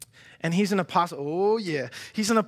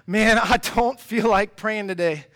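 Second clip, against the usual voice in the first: reluctant; 4 semitones higher, 6 dB louder, slower than usual. Acted. Acting out reluctance to pray.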